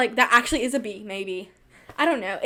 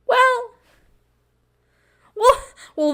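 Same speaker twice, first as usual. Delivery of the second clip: high, drawn-out mock suspense; ominous well well; 13 semitones higher, 5 dB louder, slower than usual. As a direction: sing out each well teasingly.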